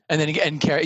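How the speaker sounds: ordinary talk, nothing marked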